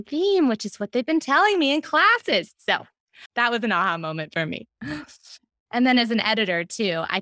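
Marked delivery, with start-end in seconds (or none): none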